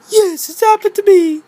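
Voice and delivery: very high toy voice; squeaky toy voice. Acted. Thomas toy agrees, very high squeak. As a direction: squeak it like a tiny engine.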